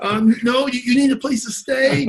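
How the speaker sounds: high, gushing voice of a thankful wife; imitating grateful wife. A grateful wife thanking Marv in a high gushing voice. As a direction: play the wife, voice lifted high with gratitude. Wife keeps offering hospitality.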